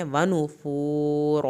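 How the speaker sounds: ونفور with rounded lips and heavy r; slow chanted word; rounded u, full r. Shown as a demonstration. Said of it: "پیش پہ ہونٹوں کو گول کریں گے".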